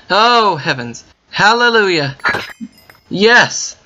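high, loud cry of relief; overjoyed hallelujah; joyful relief. Real, not acted. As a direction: cheer it like a prayer answered. Relief at finding home.

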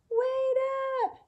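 high loud call after the herd; little zebra calling after the herd; shouted. A narrator's reading. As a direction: shout to the zebras running ahead. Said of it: "he shouted".

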